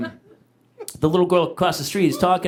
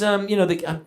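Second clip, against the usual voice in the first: ordinary talk, nothing marked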